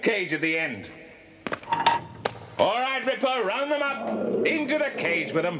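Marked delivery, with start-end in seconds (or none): 2.6-5.6 rally the dog to herd them; dog command. Acted. The boss orders his dog Ripper to round them up.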